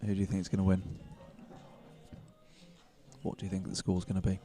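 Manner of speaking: usual speaking voice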